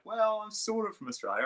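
sheepish retold reply. Coach answering curious coffee shop strangers, retold in a high hedging voice. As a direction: act out a high, non-committal reply.

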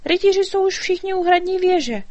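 high, eager fairy voice; little fairy, worried. A narrator's reading. Fairy explaining the king's trouble to the dragon, high and urgent.

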